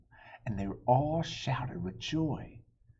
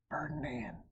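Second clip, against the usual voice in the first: usual speaking voice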